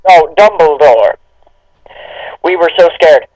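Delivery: ordinary talk, nothing marked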